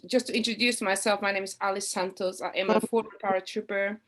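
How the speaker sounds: normal talking voice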